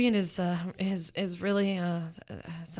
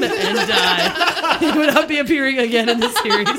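laughing; 7 semitones higher, 12 dB louder than usual. Real, not acted. Giggling through the silly plot twist.

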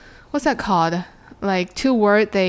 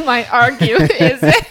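laughing; 8 semitones higher, 5 dB louder than usual. Real, not acted. Teacher laughing at joke.

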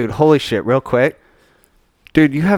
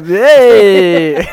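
laughing protest; 5 semitones higher, 8 dB louder than usual. Real, not acted. Reacts to a crude joke.

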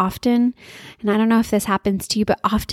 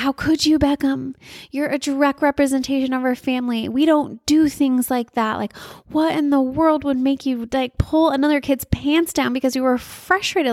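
frustrated scolding; 5 semitones higher than usual. Retold or imitated. Mother retelling how she scolded her son, frustrated. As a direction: scold in exasperated disbelief. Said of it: "being so frustrated".

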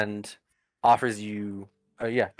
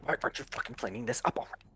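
fast, softer grumbling under the breath; angry mumble; 9 dB quieter, much faster than usual. Acted. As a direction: mumble it quickly to yourself. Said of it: "you hear him mumble".

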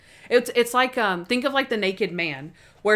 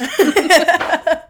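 a loud, high burst of real laughter; 7 semitones higher, 10 dB louder than usual. Real, not acted. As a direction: crack up at the punchline. Laughing at her own retelling.

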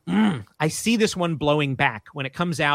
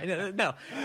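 ordinary delivery

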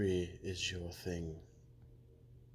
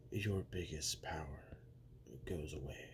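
threatening whisper; 3 semitones lower, 6 dB quieter than usual. Acted. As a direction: let the threat hang in a whisper. Menacing promo, hushed aside.

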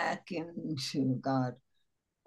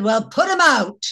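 nonchalant retort; 5 semitones higher, 10 dB louder than usual. Retold or imitated. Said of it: "She said".

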